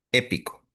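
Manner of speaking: "Épico" with the stress on its first syllable, É. Shown as a demonstration. Teacher showing students where the accent falls, first-syllable stress on "Épico". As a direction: lean on the opening É of "Épico". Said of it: "lleva acento en la E".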